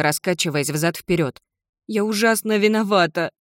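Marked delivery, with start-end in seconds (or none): 1.9-3.3 higher, plaintive wail of guilt; moaning. Acted. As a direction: wail the apology. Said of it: "простонала девочка". (виноватый стон)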